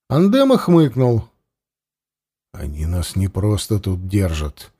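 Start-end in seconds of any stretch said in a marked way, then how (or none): 2.5-4.7 low, softer urgent pressing voice; quiet and insistent. Acted. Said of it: "тихо с нажимом заговорил Варлыга".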